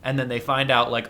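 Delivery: normal talking voice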